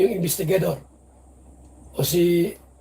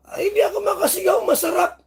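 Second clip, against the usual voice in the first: much higher, hammed-up squealing woman; coy mock squeal; 9 semitones higher than usual. Vlogger playing [name] squealing her answer to the attorney. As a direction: squeal it out coyly.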